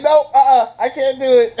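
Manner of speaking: high, loud mock refusal; girlfriend refusing. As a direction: squeal a no-way refusal. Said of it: "She was like".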